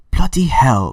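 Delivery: mock English accent outburst; bad English accent. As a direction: blurt it like a fed-up Brit, bad English accent. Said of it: "sorry if I'm doing a bad English accent".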